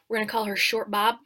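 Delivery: normal talking voice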